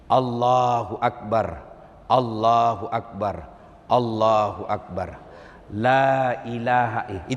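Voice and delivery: slow solemn takbir. Acted. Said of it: "lalu berkata".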